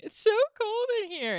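put-on high-pitched child whine; complaining children. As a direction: mimic kids whining about the cold. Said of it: "All the children are saying".

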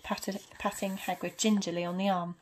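ordinary delivery

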